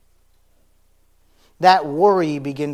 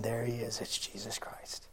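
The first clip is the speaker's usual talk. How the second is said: hushed awed whisper; 5 semitones lower, 13 dB quieter than usual. Acted. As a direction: whisper it like a secret too good to say aloud. Disciples' unspoken thought, hushed.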